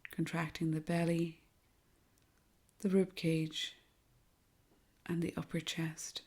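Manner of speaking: soft, hushed meditation voice with long pauses; guided meditation. Acted. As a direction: guide them gently through each breath, softly and unhurriedly.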